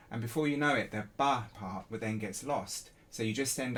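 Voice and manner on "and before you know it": ordinary delivery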